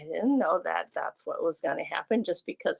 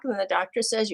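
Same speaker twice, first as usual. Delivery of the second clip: ordinary talk, nothing marked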